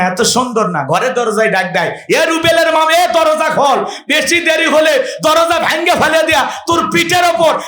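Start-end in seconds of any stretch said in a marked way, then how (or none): 2.1-6.9 rough door call. Retold or imitated. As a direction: bark at the wife to open up. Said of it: "আমাদের ব্যবহার তো এত মিষ্টি না".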